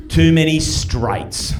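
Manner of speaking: very slow, drawn-out retold reply; weary punchline. Mate explains why he skips Mardi Gras, slow weary punchline. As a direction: stretch each word like a jaded mate.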